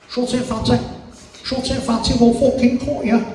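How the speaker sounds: high-pitched mock scally threat; squeaky-high mock menace from a young troublemaker; threatening scallies. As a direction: play a little yob making threats, pitched high. Two scallies threaten him, acted.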